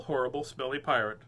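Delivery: ordinary delivery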